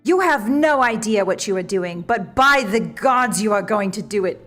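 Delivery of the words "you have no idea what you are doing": ordinary delivery, nothing marked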